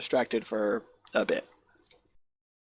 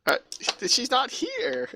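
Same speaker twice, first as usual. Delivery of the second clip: high, slow, hesitant excuse; cowed guard; 13 semitones higher, slower than usual. Acted. Guard excusing himself to the arbitrator, timid high voice.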